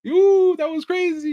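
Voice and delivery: high, loud, hooting mock LeBron voice; a gleeful dad crowing about his son's layup; LeBron impression. Imagined LeBron on the jet home, crowing high and loud about his son's layup. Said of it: "I just imagine LeBron go".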